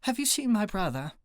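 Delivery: high British lady voice; high-pitched British accent. A narrator's reading. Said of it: "in a high-pitched British accent".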